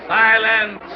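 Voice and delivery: loud, high "Silence!" to still the room; commanding shout. Acted. Showman silencing the chattering audience, loud shout. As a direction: bark the crowd into silence.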